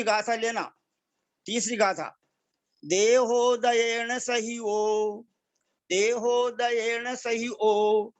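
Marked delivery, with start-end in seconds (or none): 2.8-8.1 slow, measured chanting of a Prakrit verse; verse recitation. Shown as a demonstration. Teacher reciting the verse slowly for students to repeat. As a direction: recite each line slowly and deliberately, syllable by syllable.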